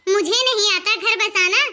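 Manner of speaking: usual speaking voice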